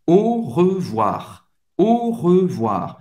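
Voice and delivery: slow "Au revoir" as o-reu-VWAR; teacher's slow model of "Au revoir"; French au revoir. Shown as a demonstration. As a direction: draw out "Au revoir", O then closed EU then WA. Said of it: "tem o som do O".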